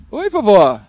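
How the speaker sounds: loud, bright hello to grandma; cheery greeting. Real, not acted.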